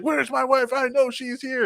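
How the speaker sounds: high, insistent jealous husband; mock insistent husband. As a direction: push it up high, stubborn.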